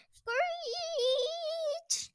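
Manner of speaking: very high, drawn-out screech; screeching brakes. A narrator's reading. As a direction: squeal like tyres braking hard.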